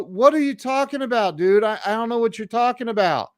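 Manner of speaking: high, retold bewildered denial; bewildered protest.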